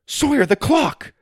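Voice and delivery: put-on high, urgent girl's cry; urgent alarm. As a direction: warn him in a rush. Urgent warning from Susie.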